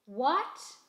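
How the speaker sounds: a loud, clipped call back over the engine; shouted what. A narrator's reading. Mechanic shouting back at Mama over the car, loud. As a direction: yell it back over the noise. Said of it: "shouted Greasy Hands".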